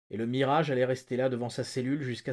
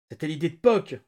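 loud, raised-pitch protest; narrated yell; 4 semitones higher, 7 dB louder than usual. A narrator's reading. Terrified prisoner blames his partner, yelling. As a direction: yell the blame onto someone else. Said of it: "hurla-t-il".

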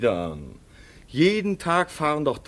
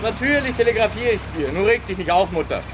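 much higher, fast, breezy; cheerful reassurance; 10 semitones higher, faster than usual. Acted. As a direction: brush off her fears brightly. Son cheerfully reassures mother. (fröhlich beschwichtigend)

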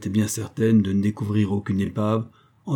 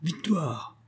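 triumphant cry; 7 semitones higher, 6 dB quieter than usual. A narrator's reading. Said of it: "cria la dame".

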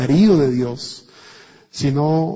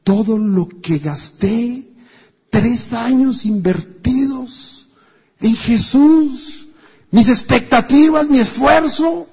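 slow and higher, rising in lament; disappointed disciples; 6 semitones higher, slower than usual. Retold or imitated. Preacher voices the disciples mourning lost hopes, slow and rising higher. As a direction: climb higher on each loss, slowly. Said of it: "ellos dicen".

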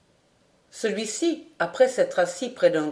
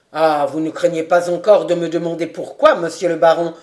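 louder, scornful low voice; contempt; 3 semitones lower, 7 dB louder than usual. A narrator's reading. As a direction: sneer down at the baron. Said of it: "répondit Daumont avec mépris". (Daumont répond au baron, plein de mépris)

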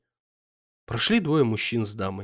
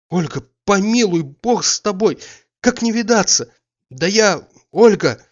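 desperate pleading; 8 semitones higher, 8 dB louder than usual. Acted. He begs her not to leave.

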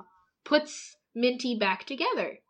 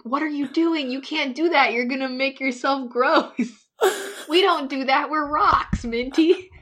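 higher-pitched, imitated dismayed telling-off; mock scolding; 5 semitones higher than usual. Candy telling Minty not to be gross, mock scolding. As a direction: act out a fussy reprimand.

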